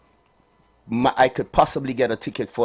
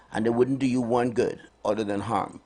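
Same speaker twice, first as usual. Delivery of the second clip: normal talking voice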